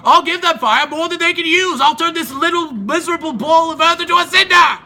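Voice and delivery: loud, high furious threat; enraged god swearing to burn the earth; raging Zeus. Acted. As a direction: roar it like a god about to strike. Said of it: "Zeus was full of rage".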